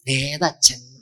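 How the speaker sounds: drawn-out, detached question; reply like an indifferent spirit, lingering on the last sound; put-on voice from the beyond; distant soul asking. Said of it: "അവിടുന്ന് ഇങ്ങോട്ട് ചോദിക്കുക".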